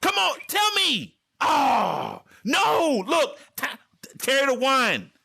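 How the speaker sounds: high, loud mock frustrated mother; frustrated mom. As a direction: nag like a mom losing patience. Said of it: "mothers get kinda like".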